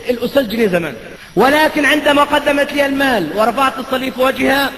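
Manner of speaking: usual speaking voice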